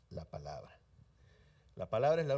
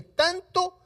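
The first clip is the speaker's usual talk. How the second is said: tanto thrown up high and loud; stretched emphasis on tanto; 14 semitones higher, 6 dB louder than usual. Acted. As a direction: lift tanto way up to marvel at it. Jesus praising the captain's faith.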